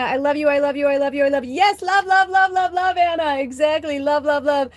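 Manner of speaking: high, loud, sing-song outpouring of love; gushing love. Real, not acted. To the host and listeners, high sing-song loving gush. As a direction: croon the love words up high to everyone.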